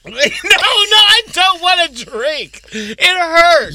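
high, half-laughing protest. Real, not acted. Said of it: "Look at me, I'm crying".

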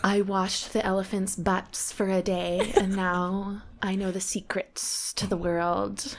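very slow mock-enlightened yoga girl. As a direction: parody a guru-like yoga girl, stretching every word. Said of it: "little blonde girls that do yoga that are like".

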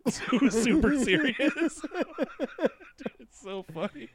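high, helpless laughing speech; words squeaking out between laughs; cracking up. Real, not acted. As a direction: try to talk through real laughter. Cracking up describing a show.

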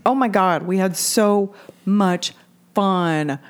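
ordinary delivery

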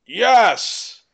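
high put-on orc reply; posing as an orc. Intruder answers the lookout as Grob, in a put-on voice.

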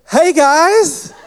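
imitating a carefree friend, high and rising; oblivious greeting. Found friend greets searchers obliviously.

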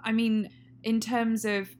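ordinary talk

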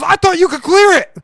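very high, loud imitated comeback; retold yelling back. Imitating passenger blaming the driver, high yell.

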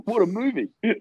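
high-pitched rave; playful over-the-top approval; mock enthusiastic fan. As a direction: gush like the film's only fan. Joins in as the devoted fan.

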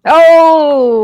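loud high burst of delight at the segue; excited whoop. Real, not acted. Co-host cheers the segue into the recap, loud excited whoop. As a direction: cheer the transition loudly.

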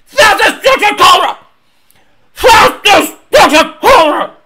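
high, loud hollering; over-excited shout. Retold or imitated. Host mimics racer hailing a record Cobra, excited shouting. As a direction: bellow it like a thrilled superfan. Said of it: "He's so excited".

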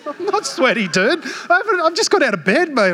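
high, fast, mock offended protest; indignant denial. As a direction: protest like the insulted dad. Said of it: "And I'm like".

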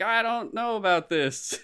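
high-pitched hesitant mock reaction; wary doubt. Viewer reacting to anime too crazy for them, wary and hesitant.

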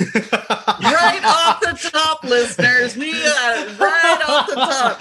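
laughing through the words; gleeful, cracking up; chuckling while announcing. Real, not acted. Cohost gleefully calls the reaction.

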